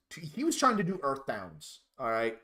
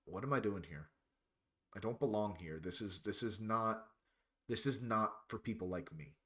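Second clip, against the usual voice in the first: quiet doubt; 5 semitones lower, 8 dB quieter than usual. Retold or imitated. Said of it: "distinctly remember going".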